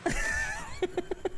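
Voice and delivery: squeaky high-pitched laugh burst; high giggle. Real, not acted. Streamer threatening a moderator in jest, high giggle. Laughing while teasing a friend.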